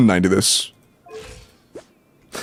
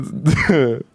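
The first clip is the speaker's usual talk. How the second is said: a muffled laugh slipping out; stifled laugh. Real, not acted. Laughing at a friend's weak team during a review, stifled laugh. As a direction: try to hold in a laugh and fail. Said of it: "why are you trying not to laugh?".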